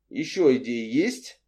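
mild, measured courtesy; understated civil question; polite. A narrator's reading. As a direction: ask slowly and politely. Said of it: "вежливо спросил Антон".

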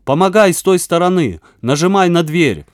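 high, loud, effortful command; straining with effort. A narrator's reading. Веня heaves at a huge stone and orders help, straining. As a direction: push the words out while heaving a weight. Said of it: "с натугой проговорил он".

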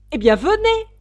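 high, very loud summons; yelled. A narrator's reading. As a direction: bellow for them to come. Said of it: "hurla la reine". (hurlement impérieux)

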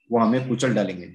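ordinary delivery

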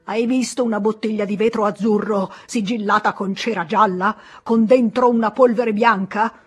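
loud, high, outraged scolding; angry lecture. Acted. Pharmacist lectures the terrified boy, loud outraged scolding. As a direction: hammer every danger home.

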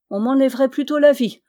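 loud, fast, high cry of gratitude; grateful exclamation. A narrator's reading. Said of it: "s'écria Yann dans sa reconnaissance". (exclamation vive de reconnaissance)

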